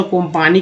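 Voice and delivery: कंपानी with "o" first and long "aa" after; alternative pronunciation. Shown as a demonstration. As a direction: say कंपानी as "kom-paa-nee". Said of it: "कंपानी क्यों नहीं बोलते हैं".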